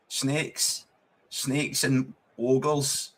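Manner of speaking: very slow, dragged-out words; slow mock menace. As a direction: draw it out like a spooky tale. Joke about the view at Ibrox.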